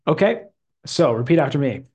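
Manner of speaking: normal talking voice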